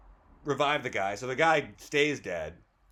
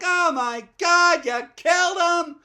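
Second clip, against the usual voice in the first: high, loud hysterical shriek, acted out; hammed-up panicked fiancée; freaking out; 11 dB louder than usual. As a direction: wail it in mock horror, drawn out. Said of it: "she freaks out".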